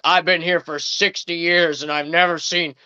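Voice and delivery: slow, indignant usher impression. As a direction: draw it out as a scandalized old churchgoer. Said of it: "raises his voice".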